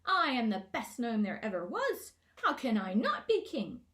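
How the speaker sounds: loud puffed-up bragging; boastful shout. A narrator's reading. Gnome king bragging to the crowd of gnomes, loud shout. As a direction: brag as if no one could doubt it. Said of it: "As Glimfinkel shouted".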